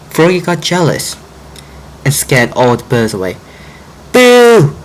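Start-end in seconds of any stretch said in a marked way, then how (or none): 4.1-4.7 loud, high shout; yelled boo. Acted. Frog shouting to scare birds away, loud. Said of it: "Frog yelled".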